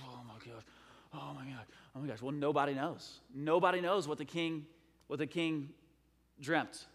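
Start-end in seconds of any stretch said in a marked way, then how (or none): 0.1-2.2 hushed mock panic, very soft; freaking out. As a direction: mutter in pretend dread under your breath. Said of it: "they're freaking out".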